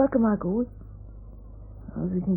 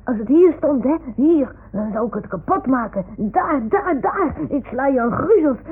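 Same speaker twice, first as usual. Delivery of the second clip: high, loud smashing tantrum; loud, worked-up threats; acted fury; 4 semitones higher, 7 dB louder than usual. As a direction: shout it as if striking blows. Acts out smashing the hated house.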